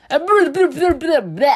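high, loud fussy pot voice; a picky pot saying no; pot refusing. Acted. Pot rejecting tomatoes, loud and fussy.

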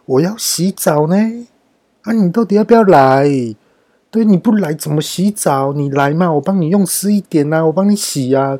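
put-on high, wheedling drunken pleading; drunk coaxing the soap. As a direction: coax the slippery soap like a drunk. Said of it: "我就跟肥皂说". (醉醺醺地哄肥皂，声音拔高)

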